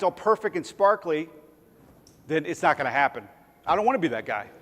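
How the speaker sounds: usual speaking voice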